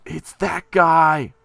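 real excited outburst, high and loud; excited recognition. Real, not acted. Player recognizing that guy from pictures, thrilled.

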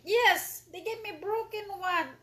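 loud, high-pitched pretend complaint; mock upset. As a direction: playfully wail about a letdown. Mock upset over broken item.

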